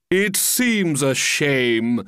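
very slow, drawn-out regret; pompous walrus. Acted. Walrus regrets tricking the oysters, slowly and pompously. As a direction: lament it with grand show. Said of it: "the walrus said".

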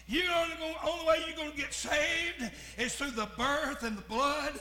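full-force preaching. Acted. Preacher shouting to the congregation about the cross, full force. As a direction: preach it out over the organ.

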